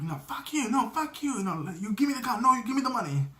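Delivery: high-pitched, playful back-and-forth squabble; mock bickering. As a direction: imitate two men arguing, pitch jumping up with each retort. Acts out two characters bickering.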